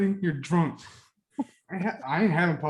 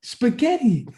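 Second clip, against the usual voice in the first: indignant outburst; 8 semitones higher, 6 dB louder, slower than usual. Real, not acted. Disgusted echo of wife's idea.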